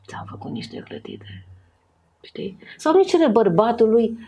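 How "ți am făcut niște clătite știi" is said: much softer, breathy tempting whisper. Retold or imitated. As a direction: whisper it like a sweet offer. Imită șoapta seducătoare. (soția îi oferă clătite soțului, în șoaptă)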